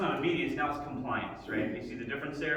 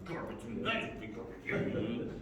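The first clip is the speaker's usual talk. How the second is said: slow, soft, grudging mutter of "Garbage, nice big garbage"; sullen mutter; 4 semitones lower, 6 dB quieter, slower than usual. Shown as a demonstration. Kid grudgingly mutters about the garbage chore. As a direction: drag it out like a resentful teen. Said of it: "Or you do".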